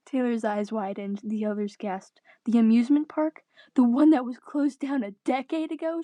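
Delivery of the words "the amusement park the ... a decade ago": louder, higher disbelieving cry; shocked exclamation. Acted. Taylor reacts to the abandoned park idea, shocked and louder. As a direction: gasp it out in disbelief. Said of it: "she exclaimed".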